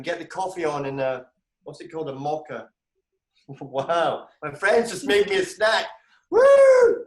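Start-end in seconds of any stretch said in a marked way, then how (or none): 6.3-7.0 a high, loud, happy woo; delighted whoop. Real, not acted. Thanking friends for a surprise snack, delighted whoop. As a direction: cheer as the snack arrives.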